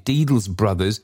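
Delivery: usual speaking voice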